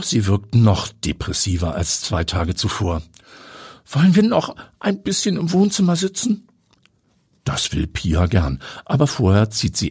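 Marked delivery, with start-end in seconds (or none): 3.9-6.5 lightly voiced higher woman, gentle question; Heidi's voice. A narrator's reading. Heidi invites her friend to sit, higher woman's voice.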